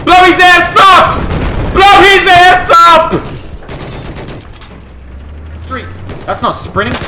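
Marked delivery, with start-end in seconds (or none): 0.0-1.2 hyped shout. Real, not acted. Hyped cheering. 1.7-3.1 drawn-out high shout; slow hyped shout. Real, not acted. Repeated hype shout.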